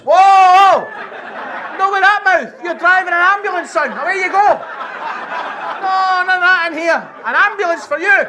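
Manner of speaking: high, loud prim scolding voice; mock scandalised matron. To the rude new recruit, high loud scolding.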